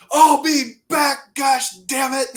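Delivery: loud, high, slow mock yell; drawn-out retold outburst; imitated fired-up coach. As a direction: storm out shouting, as the coach. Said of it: "Coach Bray's fired up".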